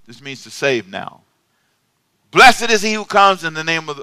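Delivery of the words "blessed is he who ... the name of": loud preached scripture line; preaching at full force. Acted. Preacher proclaiming scripture to the church, loud.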